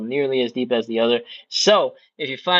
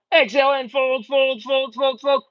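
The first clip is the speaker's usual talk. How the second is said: straining mid-stretch; 11 semitones higher than usual. Real, not acted. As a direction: talk through the effort of the fold. Coach folding along with class.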